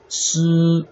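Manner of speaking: 思 held high and level; first tone. Shown as a demonstration. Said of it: "first tone".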